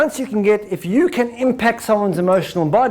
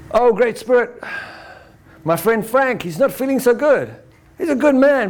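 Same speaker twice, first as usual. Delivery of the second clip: shaman's prayer; 4 semitones higher than usual. Retold or imitated. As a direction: call out to the spirit in a prayer voice. Shaman prays to Great Spirit.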